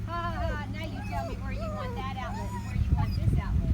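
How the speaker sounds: high mocking laugh, then teasing; imitating Daddy. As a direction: ham up dad's teasing voice. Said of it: "and now Daddy said".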